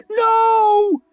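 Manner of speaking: very high, loud squeal; a high, loud desperate squeal. A narrator's reading. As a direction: refuse in terror. Dragged away.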